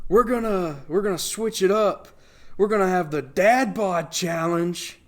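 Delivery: high, loud, slow host announcement; announcer voice. Retold or imitated. Host announcing the dad bod challenge, loud high hype. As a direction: hype it like a contest emcee.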